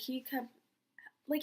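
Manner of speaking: usual speaking voice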